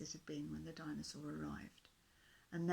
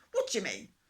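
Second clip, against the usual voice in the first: louder, snapping question; cross dinosaur; 12 dB louder than usual. A narrator's reading. Dinosaur crossly demands an answer. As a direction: bristle at the little fish. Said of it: "said the dinosaur crossly".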